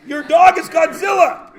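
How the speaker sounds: loud laughing shout; mock outrage. Real, not acted. Teacher joking at the class, loud laughing shout. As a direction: cry it out with a laugh. Teacher mocking a wrong answer.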